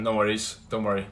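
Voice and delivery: usual speaking voice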